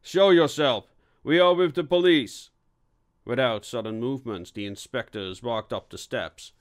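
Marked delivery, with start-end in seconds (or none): 0.0-2.5 raised, commanding police call; stern. A narrator's reading. Police inspector to hidden intruder, sternly calling out. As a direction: order them out loudly. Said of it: "said Alborough sternly".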